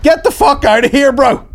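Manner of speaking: high, loud, hammed-up outburst; exasperated shout. Guest fed up with the rambling host, mock furious shout. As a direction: explode at someone who won't stop talking.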